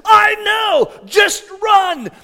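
high, very loud shouted command; exasperated yell. Retold or imitated. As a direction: yell back over a screaming child. Father yelling back at son.

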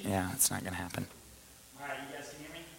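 faint and distant, away from the microphone; talking with the mic cut out. Real, not acted. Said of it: "my mic was off".

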